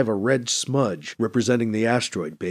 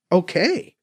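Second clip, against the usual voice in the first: disbelieving; 6 semitones higher than usual. A narrator's reading. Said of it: "says in disbelief".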